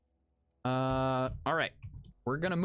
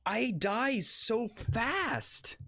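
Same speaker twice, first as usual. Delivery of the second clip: slow, high, drawn-out whine; dying again, slow high whine; whiny complaint; 7 semitones higher, much slower than usual. Real, not acted. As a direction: stretch it out in a mock whimper.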